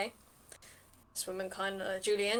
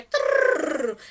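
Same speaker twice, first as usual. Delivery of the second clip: loud trilled toppling noise; 11 dB louder than usual. Acted. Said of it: "they kind of just go".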